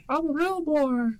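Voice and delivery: put-on slow squeaky falsetto wooden boy; Pinocchio voice. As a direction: stretch it out in a high cartoon voice.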